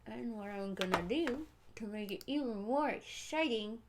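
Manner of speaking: talking around a pen; pen held in the mouth; mouth full. Real, not acted. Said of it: "I got a pen in my mouth right now".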